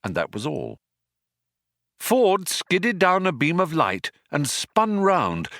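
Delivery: ordinary delivery, nothing marked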